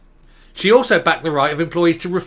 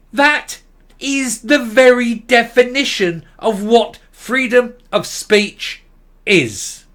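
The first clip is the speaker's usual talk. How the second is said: slow, loud, higher-pitched, word-by-word insistence; emphatic rebuttal; 6 semitones higher, 4 dB louder, slower than usual. Real, not acted. Commentator rebutting her on freedom of speech, emphatic rebuttal. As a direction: hammer out each phrase slowly and forcefully.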